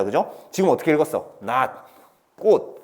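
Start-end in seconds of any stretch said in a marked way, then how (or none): 1.4-2.8 낫 and 꽃 each one syllable with unreleased t; correct natural reading. Shown as a demonstration. Teacher shows students how they read, 낫 and 꽃 with stopped t. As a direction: separate 낫, 꽃, each ending on a stopped t. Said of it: "요렇게 읽는단 말이야".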